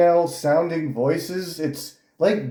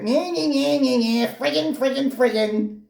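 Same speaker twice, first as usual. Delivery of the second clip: high, nasal-free mock whine, much higher; mocking whiny voice; 11 semitones higher than usual. Mocking the YouTuber's whiny rant voice. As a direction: mimic a whiny man-child, pitched way up. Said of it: "He's got the most cringe voice you'd ever heard".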